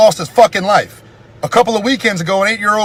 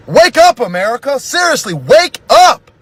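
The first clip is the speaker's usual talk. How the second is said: urgent wake-up call to America; 4 semitones higher than usual. Real, not acted. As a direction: shout it to the whole country. Calling out to the country.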